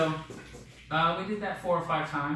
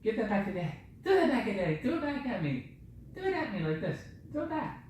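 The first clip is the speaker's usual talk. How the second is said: put-on high, coaxing baby talk; 8 semitones higher, 4 dB quieter, faster than usual. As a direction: coax a toddler in a high voice. Coaxing the toddler to throw.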